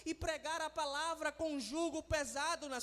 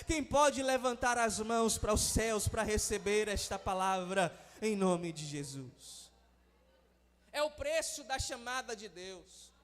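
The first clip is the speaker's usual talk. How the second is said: loud, impassioned preaching over music; preaching at full force; 6 dB louder than usual. Acted. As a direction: build the fire of a revival sermon, stretching key words. Pastor preaching to congregation. (pregação inflamada e forte)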